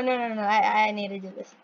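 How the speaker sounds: usual speaking voice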